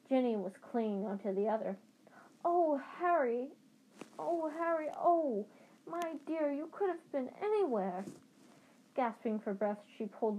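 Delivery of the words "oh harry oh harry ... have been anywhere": high-pitched motherly fretting. A narrator's reading. Frantic mother reaches the lost boy, high fretting. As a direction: fuss over him with anxious relief.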